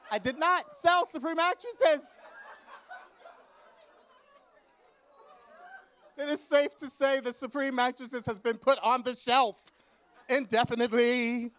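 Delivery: high, loud laughing declaration; emphatic yell. Retold or imitated. Author insisting to the crowd she did not sell, shouted and laughing.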